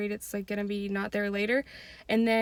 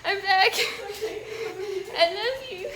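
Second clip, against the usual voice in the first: high, laughing with a catch of tears; giggling, near-tearful goodbye; laugh-crying, high and gleeful; laughing and teary; 10 semitones higher, much slower than usual. Real, not acted. Laughing, emotional sign-off.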